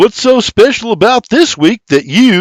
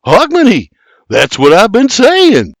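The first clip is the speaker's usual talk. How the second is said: sheepish concession, much higher; grudging concession; 10 semitones higher, 6 dB louder than usual. Acted. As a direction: give in with a crooked nod. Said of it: "with a crooked nod replies".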